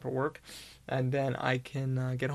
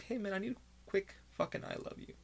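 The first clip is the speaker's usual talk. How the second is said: soft, higher plea to a friend; half-whispered plea for comfort; asking a friend softly; 6 semitones higher, 8 dB quieter than usual. Acted. Calling a friend for support.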